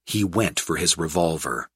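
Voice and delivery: ordinary delivery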